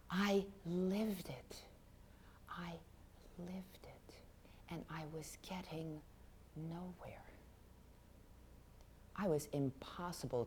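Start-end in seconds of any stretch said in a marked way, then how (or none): 0.1-1.6 intense. Acted. Writer recalling her obsession. 2.5-7.2 raised, much softer half-whisper; hushed despair. Acted. Writer admitting she was getting nowhere, hushed despair. Writer recalling hopeless obsession.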